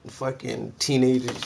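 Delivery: ordinary talk, nothing marked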